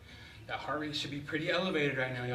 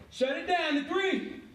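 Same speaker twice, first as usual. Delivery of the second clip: called-out countdown; 9 semitones higher, much slower than usual. Real, not acted. Coach ending the set.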